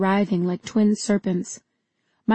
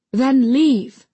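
loud, higher, angry dismissal; angry order; 4 semitones higher, 6 dB louder than usual. A narrator's reading. Amar sends her to the door, angrily raising his voice. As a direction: order them out with raised voice. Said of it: "gesturing to the door angrily".

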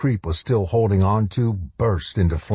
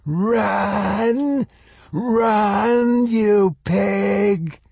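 high, drawn-out monster bray; creature's piercing cry, each word stretched; 14 semitones higher, much slower than usual. A narrator's reading. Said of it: "the thing behind her brayed".

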